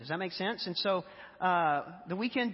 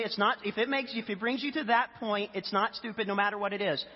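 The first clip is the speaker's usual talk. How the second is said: ordinary delivery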